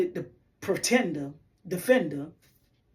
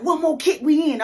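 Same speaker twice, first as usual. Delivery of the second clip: excited urging; 8 semitones higher, 6 dB louder than usual. Retold or imitated. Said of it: "He was like".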